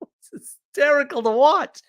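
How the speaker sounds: real laughter on the words; laughing. Real, not acted. Teller to listeners, laughing at the story.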